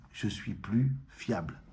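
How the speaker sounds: quiet, slow, low voice of the body confessing; body's voice. Acted. Teacher voicing the body to listeners, low and slow. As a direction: let the body admit it, slow and hushed. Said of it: "il annonce et il dit".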